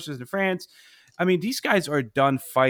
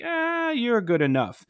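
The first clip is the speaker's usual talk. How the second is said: a hint of a smug officer, pitched up and drawn out slowly; dismissive British officer; 9 semitones higher than usual. British officer telling seized American sailors they'll do, mildly mocking. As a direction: toss it off lightly, like waving them aboard anyway.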